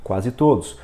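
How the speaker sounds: QUASE TODOS run together without a gap; in one glance. Shown as a demonstration. Teacher shows two words taken at once, in one glance. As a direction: read both words as one unit. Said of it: "você dá um golpe de vista".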